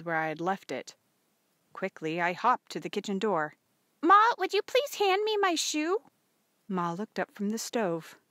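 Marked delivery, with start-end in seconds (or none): 4.0-6.1 put-on very high, loud girlish call; daughter calling Ma. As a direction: call out a request to mother. Calling to mother from kitchen door.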